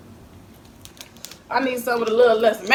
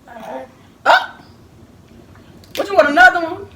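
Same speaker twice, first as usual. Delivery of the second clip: loud, high What you want another one; surprised mock scold; 9 semitones higher, 11 dB louder, slower than usual. Real, not acted. Mother teasing her greedy baby, loud and high.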